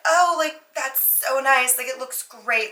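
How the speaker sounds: high, bright put-on praise, slow; forced polite enthusiasm. Narrator faking delight at the roommate's wolf room, high and slow. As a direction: gush politely while not knowing what to say.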